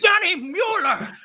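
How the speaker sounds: high, slow drawn-out wail of the name; mock anguished cry. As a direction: wail the name in hammed-up despair. Mock lament over lost pick.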